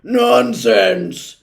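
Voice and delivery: very loud, high dismissive roar. A narrator's reading. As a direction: roar it out as the outraged doctor. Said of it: "the doctor roared".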